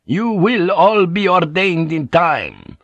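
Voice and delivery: slow, high, emphatic pronouncement; stern insistence. A narrator's reading. Superior priest answering a rebellious brother, sternly insistent. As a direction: lay it down with strained authority.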